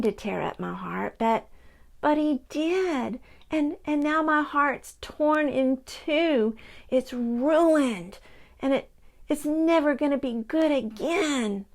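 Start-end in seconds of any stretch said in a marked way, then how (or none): 2.0-11.6 a touch of heartbreak; sad puppy. Acted. Pocket Puppy lamenting his ruined heart to Grandma, mildly sad.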